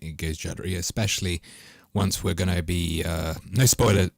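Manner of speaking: usual speaking voice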